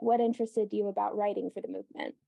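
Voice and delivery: normal talking voice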